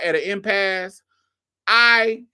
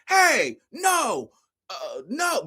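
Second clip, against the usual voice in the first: mock shouted protest; 8 semitones higher, much slower than usual. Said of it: "But he can't speak English".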